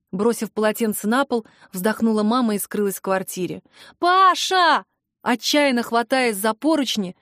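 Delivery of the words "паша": high-pitched shout stretched over the name; desperate shriek. A narrator's reading. Sister clutching the railing above the fight, desperate shriek. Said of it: "взвизгнула я почти охрипшим голосом".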